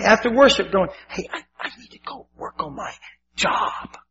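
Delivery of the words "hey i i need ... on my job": hushed, furtive whisper; whispered excuse. Retold or imitated. Husband excuses himself to wife after worship, whispering. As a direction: whisper a lame excuse to slip away.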